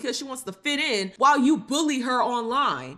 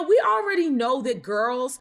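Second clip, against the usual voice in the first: ordinary talk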